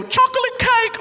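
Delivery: high squeaky catchphrase; quoted catchphrase. Acted. Cop imitating the comedian's joke, high-pitched. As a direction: sing it out way up high. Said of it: "and when you go".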